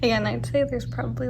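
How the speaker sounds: normal talking voice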